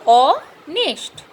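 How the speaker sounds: ऑनेस्ट said slowly as "o-nest", H silent; slow silent-h demo. Shown as a demonstration. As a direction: draw out ऑनेस्ट in parts, no h. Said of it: "हॉनेस्ट नहीं पढ़ते हैं".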